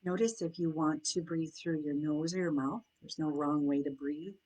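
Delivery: soft, unhurried meditation voice with long pauses; guided meditation. Acted. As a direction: guide them gently into noticing their breath and senses.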